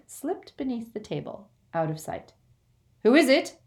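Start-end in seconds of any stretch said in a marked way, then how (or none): 3.0-3.6 loud call toward the door. Acted. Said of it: "Bill called".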